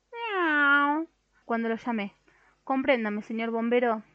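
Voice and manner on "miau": high meow rising at the end; the narrator imitating the cat on the phone, rising meow. A narrator's reading. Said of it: "cosas horribles como".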